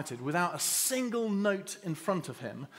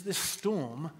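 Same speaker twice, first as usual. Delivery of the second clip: normal talking voice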